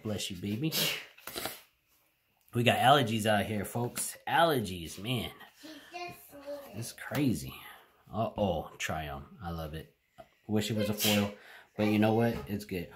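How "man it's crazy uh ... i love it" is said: ordinary talk, nothing marked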